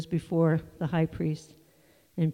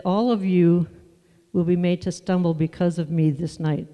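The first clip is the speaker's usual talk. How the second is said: "All" pushed out loud and strong; stress on ALL; 5 dB louder than usual. Shown as a demonstration. Pastor reading Jesus' warning to the disciples, stressing "All". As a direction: lean hard on "All of you". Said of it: "All of you, it says".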